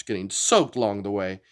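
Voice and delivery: plain reading voice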